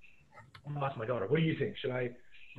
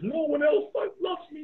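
put-on whiny teenager; 13 semitones higher than usual. Teacher mocking lovesick Romeo as a whiny teen. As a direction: whine it like a sulky teen. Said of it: "teenage stuff".

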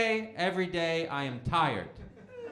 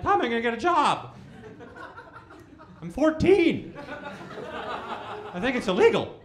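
mock whiny teen protest; 8 semitones higher than usual. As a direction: squeal it like an outraged fourteen-year-old. Teen self protesting, retold.

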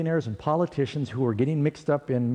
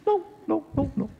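high, dismissive noes from passing drivers; mocking refusals; 12 semitones higher than usual. Drivers refusing the hitchhiker, high mock refusal. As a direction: brush him off, pitched up. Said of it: "they're saying".

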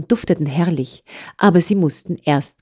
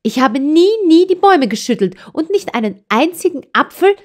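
put-on high, hurt child's protest, louder; indignant girl; 11 semitones higher, 3 dB louder than usual. A narrator's reading. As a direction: cry out the innocence of a wronged child. Child protests false accusation. (empörtes Mädchen, hoch und laut)

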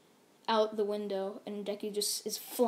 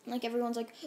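usual speaking voice

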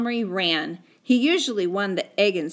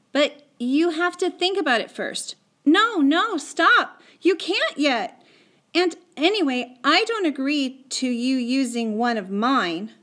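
put-on higher, insistent boy's objection; urgent protest; 6 semitones higher, 4 dB louder than usual. Omri stopping Patrick from using the cupboard, urgent protest. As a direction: protest anxiously and try to stop him. Boy protesting friend's dangerous plan.

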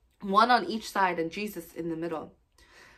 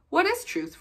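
retort; 10 semitones higher than usual. Acted. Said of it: "retorted Pilate".

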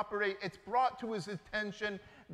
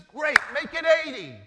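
much louder, higher, sly and eager; eager offer; 6 semitones higher, 11 dB louder than usual. Acted. Manager to second debtor, loud eager offer. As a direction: seal the shady deal with gusto.

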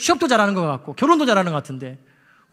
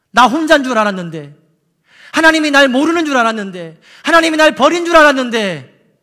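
rising higher and louder with each line; building preached cry; 5 semitones higher, 7 dB louder than usual. Acted. Preacher voicing Elijah's realisation, building loud and high.

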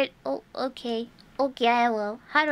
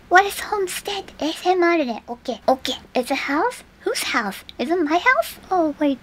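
usual speaking voice

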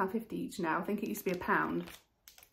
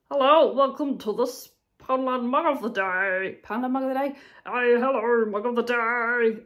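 mock show host; 8 dB louder than usual. Vlogger pretends to host Poundland Mug of the Day, hammed-up. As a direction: play a cheesy TV host for a moment.

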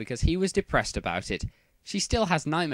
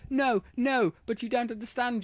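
high, hammed-up pleading boyfriend; 11 semitones higher than usual. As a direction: beg her not to leave, mock desperate. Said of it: "Draco pleaded".

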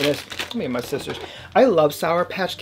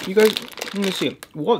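usual speaking voice

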